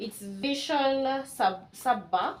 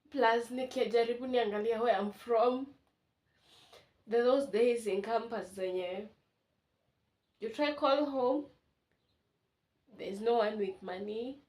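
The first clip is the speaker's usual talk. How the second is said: ordinary talk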